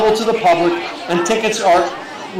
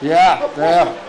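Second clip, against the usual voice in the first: normal talking voice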